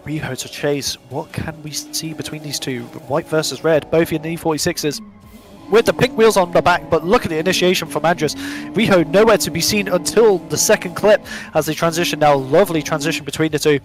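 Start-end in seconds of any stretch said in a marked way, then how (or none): 5.7-13.8 excited play-by-play, punching key words louder; live race commentary. Real, not acted. Commentator calling a drift battle to viewers, excited live commentary. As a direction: call the chase as it happens, driving and insistent.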